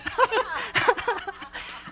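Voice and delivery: high, bursting laugh; real laughter. Real, not acted. Laughing about people giving him money for dancing, high bursting laugh. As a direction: let a delighted high laugh out. Laughing at being paid to dance.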